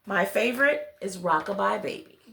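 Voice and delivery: ordinary delivery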